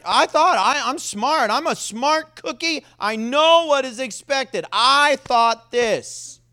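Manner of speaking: high, loud, self-important boasting; mock conceit, pitched well up; smug know-it-all. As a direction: play him puffed up, punching each "I". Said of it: "Say it with emphasis. "I thought".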